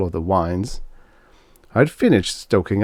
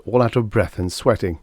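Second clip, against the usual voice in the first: ordinary delivery, nothing marked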